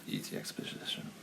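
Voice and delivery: very low, soft, slurred mutter; mumbled. Real, not acted. Cohost admitting a plot hole, mumbled so it gets missed. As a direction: trip quietly over the words, almost voiceless. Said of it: "Say again?".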